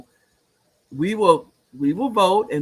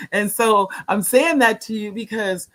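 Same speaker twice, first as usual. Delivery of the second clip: laughing through the words; 7 semitones higher, 4 dB louder than usual. Real, not acted. Laughing after age joke.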